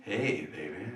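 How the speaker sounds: put-on low, smooth "hey baby".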